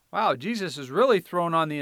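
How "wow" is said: normal talking voice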